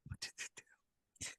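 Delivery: hushed, voiceless whisper of suspense; horrified whisper. Real, not acted. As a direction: whisper it as if hearing a confession. Co-host's hushed mock-horrified reaction.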